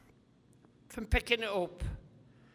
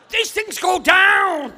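much higher and louder, slow and drawn out; big comic shout; 11 semitones higher, 17 dB louder, much slower than usual. Real, not acted. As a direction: belt out the punchline slowly. Punchline about his own speech.

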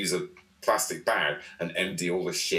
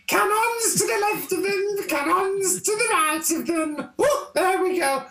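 very high, loud camp poet voice; camp Tennyson; 5 dB louder than usual. Retold or imitated. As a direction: declaim it in shrill camp falsetto. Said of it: "it sounds quite camp".

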